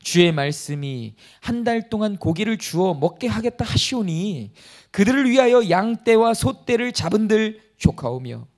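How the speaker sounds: loud, solemn Bible reading; scripture reading. Acted. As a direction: read the verse out to the congregation. Reads scripture aloud. (힘 있게 성경 봉독)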